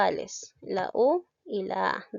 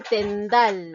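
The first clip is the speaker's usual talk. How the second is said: "tendal" slow and loud, weight on the final dal; stress on DAL; 6 dB louder than usual. Shown as a demonstration. Teacher shows the last word's strong syllable, stress on DAL. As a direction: draw out "tendal" and hit the dal. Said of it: "lleva la mayor fuerza de voz en la sílaba".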